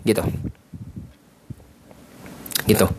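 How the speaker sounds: usual speaking voice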